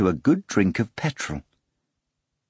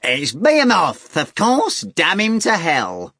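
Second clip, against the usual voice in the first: nasal voice; 9 semitones higher, 4 dB louder, slower than usual. A narrator's reading. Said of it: "An unpleasant nasal voice replied".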